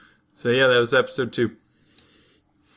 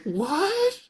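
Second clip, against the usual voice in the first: very high mock shock; high shocked what; shocked gasp. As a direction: squeal it in hammed-up disbelief. Shocked by betrayal.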